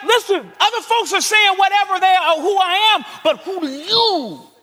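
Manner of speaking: loud, high preaching voice; fiery sermon delivery; full-force preaching. Acted. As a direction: preach it out hard.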